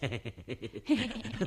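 sly mocking laugh; a smug little snigger; sneering chuckle. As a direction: chuckle at her expense. Basilio sneers at the maid.

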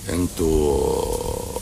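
انتوا stretched very slowly, low and soft; drawn out. Retold or imitated. Doctor calls out to people to see who is awake, long drawn out انتوا. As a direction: drag out انتوا like a sleepy call into the room. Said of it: "قلت لهم".

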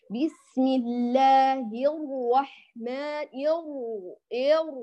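slow recitation, الرحمن with an overdone dark r; affected heavy ra. Shown as a demonstration. Teacher imitates sisters who overdo the heaviness, affected ra. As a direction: swell the r in ير, laboured and too heavy. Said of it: "لدرجة تكلف".

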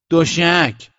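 loud, high call back; voice from the dark. A narrator's reading. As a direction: shout the answer out of the darkness. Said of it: "صدایی از توی تاریکی اومد". (صدای بلند از تاریکی)

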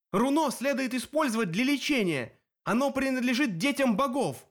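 high-pitched heated argument; indignant protest. A narrator's reading. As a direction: argue back hotly, pitch well up. Hero argues with the cyclops.

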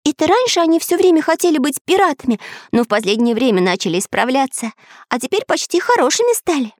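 put-on high quick boy voice; Pencil's voice. A narrator's reading. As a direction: chatter it high and fast.

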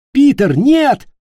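high, loud cry to stop; alarmed shout. A narrator's reading. Ник leaps between Питер and captain, shouting. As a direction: scream to stop him. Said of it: "закричал Ник".

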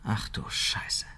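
breathless whispered curse; whispered shock. Acted. Colleague hearing of the affair, whispered shock. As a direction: mutter it under your breath, stunned. Shocked aside in tense meeting.

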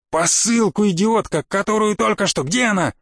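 exasperated, high-pitched insult and demand; angry shouting. Acted. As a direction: berate the secretary in a frantic hurry. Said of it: "крикнул секретарше".

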